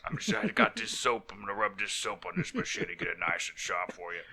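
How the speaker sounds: low, soft dopey intruder voice; mock dim intruder. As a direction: play the clueless intruder, murmured low. Mocking the intruder's logic.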